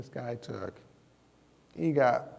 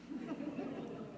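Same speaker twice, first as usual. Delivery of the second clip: laughing; 14 dB quieter than usual. Real, not acted.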